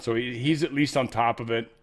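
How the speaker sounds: ordinary talk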